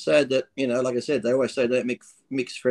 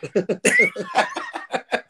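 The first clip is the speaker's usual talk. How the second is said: high burst of laughter; 1 big laugh. Real, not acted. Laughing at the joke.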